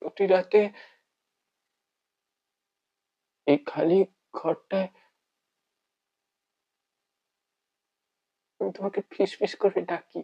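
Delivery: hushed, halting monologue with long pauses; heartbroken lover. Acted. Bereft lover speaking to the one who is gone, heartbroken.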